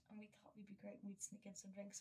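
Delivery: hushed, sneaky confession; whispering about smuggling drinks into the ball; conspiratorial whisper. Real, not acted. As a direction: whisper it like a secret plan.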